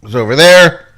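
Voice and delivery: high, energetic host opener; show host intro. Acted.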